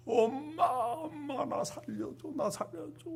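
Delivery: a small bird begging to be saved; crying for help. Retold or imitated. Falling eaglet calling mother, high soft crying plea.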